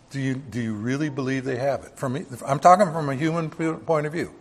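normal talking voice

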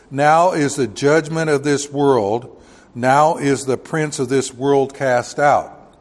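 loud, slow proclaiming of the verse; preaching scripture reading. Acted. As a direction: thunder the verse out from the pulpit. Preacher reads scripture aloud.

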